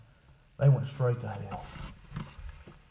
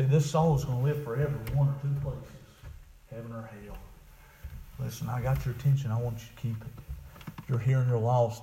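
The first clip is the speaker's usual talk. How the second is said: ordinary talk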